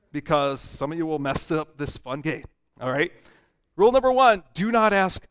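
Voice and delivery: ordinary delivery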